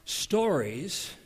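full-voiced sermon delivery; preaching. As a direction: preach it out to the congregation. Preaching the opening.

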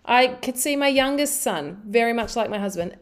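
ordinary delivery

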